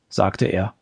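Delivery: ordinary delivery, nothing marked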